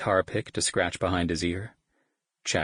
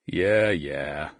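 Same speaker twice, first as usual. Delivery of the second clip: groaning; 2 semitones lower, 3 dB louder than usual. Acted. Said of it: "Devon groaned".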